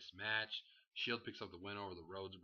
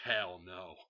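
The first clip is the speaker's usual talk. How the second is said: loud, falling flat no; loud scoffing hell no; emphatic refusal; 2 semitones lower, 7 dB louder than usual. Real, not acted. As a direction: slam the door on it. Rhetorical answer, emphatic refusal.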